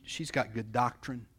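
normal talking voice

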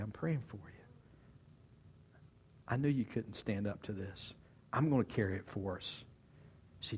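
low, soft, loving voice; tender reassurance. Acted. Jesus in chains to Peter who failed him, tender. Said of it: "That look of".

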